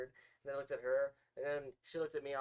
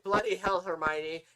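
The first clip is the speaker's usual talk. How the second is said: loud mock exasperation; Ron impression; 9 dB louder, slower than usual. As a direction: blurt it like Ron. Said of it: "Ron will say".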